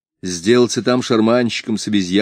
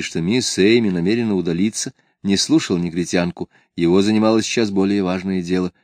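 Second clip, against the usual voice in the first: usual speaking voice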